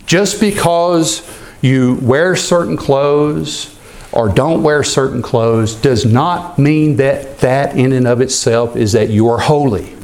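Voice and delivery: normal talking voice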